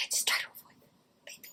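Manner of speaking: hushed, teasing pickup-line whisper; mock seductive whisper.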